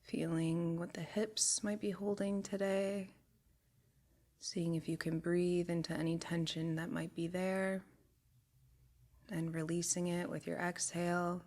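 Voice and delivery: calm body-scan voice, long pauses between phrases; guided meditation. Real, not acted. Guide taking a group through a body scan, soothing meditation voice. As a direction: guide them unhurriedly, letting each phrase settle.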